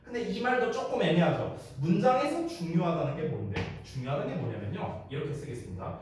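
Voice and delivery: ordinary talk, nothing marked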